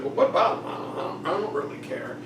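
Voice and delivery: soft, half-whispered shrug; quiet, careless king; shrugging nonchalance. Retold or imitated. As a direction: brush the question off under his breath. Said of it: "He's like".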